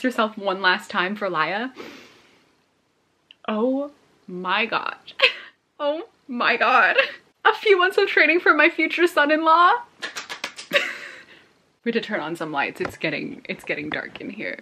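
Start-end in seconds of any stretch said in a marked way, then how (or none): 3.5-7.0 tearful oh my god. Real, not acted. Overwhelmed by book twist. 7.4-9.7 much higher and louder, squealing with delight; excited squeal. Real, not acted. Squealing at book twist. 9.8-11.1 breathless high-pitched giggle; thrilled unvoiced laugh; airy high giggle. Real, not acted. Laughs after squeal.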